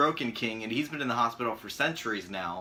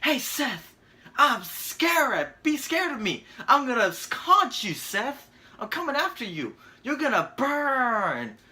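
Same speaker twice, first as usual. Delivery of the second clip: much higher, louder creepy mock voice, slowing at the end; mock Bray Wyatt; 11 semitones higher, 4 dB louder, slower than usual. Reviewer mocking Bray Wyatt's weekly threats to Seth, high creepy mock voice. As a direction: taunt Seth like a cartoon villain.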